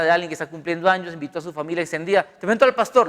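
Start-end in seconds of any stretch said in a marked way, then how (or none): 2.2-3.1 bright, louder introduction; mock host presenting the pastor; party host introducing. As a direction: announce him like a proud host.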